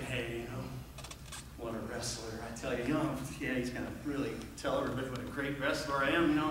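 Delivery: low, soft muttered musing; hushed, low self-satisfied aside; private inner thought. Retold or imitated. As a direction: mutter proudly to yourself. Voicing his own proud thoughts.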